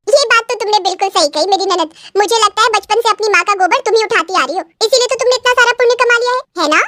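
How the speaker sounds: mock agreement that climbs in pitch; sarcastic daughter-in-law. Bride needling sister-in-law about merit, sarcastic.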